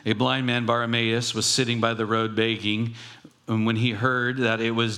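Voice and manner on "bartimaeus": normal talking voice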